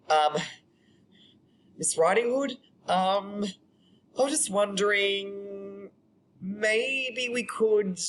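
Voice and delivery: high, slow, halting awkward suitor; hesitant stammered invitation. Acted. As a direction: fumble shyly through asking someone out. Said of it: "tried to say".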